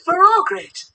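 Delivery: high, excited character voice; excited teammate. Acted. Teammate cheers the whole team, high excited voice.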